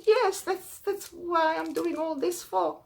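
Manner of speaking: high, pleading voice of a poor traveller; pleading poor man. Acted.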